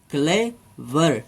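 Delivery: "Clever" को धीरे "clay… वर" तोड़कर; "क्लेवर" के दोनों सिलेबल के बीच साफ़ ठहराव; दो हिस्सों में. Shown as a demonstration. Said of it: "दो हिस्सों में इसे हम". ("क्लेवर" सिखाते हुए: "क्ले", रुकें, "वर")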